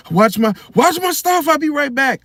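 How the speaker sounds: acted urgent call-out. Shopper slips out after faking an attack, high urgent call. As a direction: call back to bystanders as you rush off. Acted excuse to flee the store.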